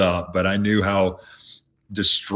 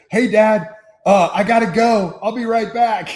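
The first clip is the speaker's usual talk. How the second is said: high, louder imitation of a breezy son; Zach; 12 semitones higher, 6 dB louder than usual. Son excusing himself to dad when coach calls, imitated breezy. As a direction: pitch it up like a kid dashing off. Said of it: "Zach goes".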